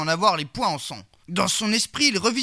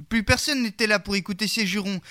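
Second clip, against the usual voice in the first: ordinary delivery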